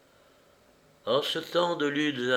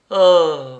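loud drawn-out relieved ahh; long sigh of relief; 4 semitones higher, 12 dB louder than usual. A narrator's reading. Beadle after gulping his drink, loud relieved sigh. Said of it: "avec un long soupir de soulagement".

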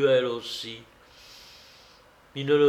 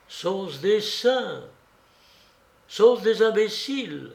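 higher, swinging questioning tune; bewildered wondering; 7 semitones higher than usual. Acted. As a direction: puzzle aloud, baffled by these people. Jules wondering alone at night.

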